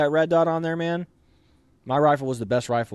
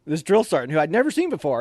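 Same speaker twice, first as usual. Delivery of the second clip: ordinary talk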